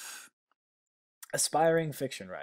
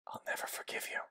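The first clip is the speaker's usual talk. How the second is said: hushed, voiceless threat; whispered vow of revenge; 5 dB quieter, faster than usual. Real, not acted. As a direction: breathe it out as a grudge. Grudge after the scare.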